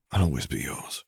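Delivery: tender near-whisper, narrated; whispered endearment. A narrator's reading. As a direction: murmur it softly, close to an ear. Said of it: "he whispered in her ear".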